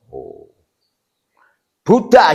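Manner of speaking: ordinary talk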